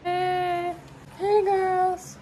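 ordinary talk

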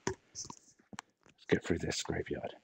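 quiet and slow, under the breath; hushed. Real, not acted. Player sneaks into a spooky graveyard, hushed.

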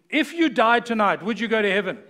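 mock blunt question. Pastor acting out approaching a stranger, loud and laughing. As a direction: hit them with it, loud and bright.

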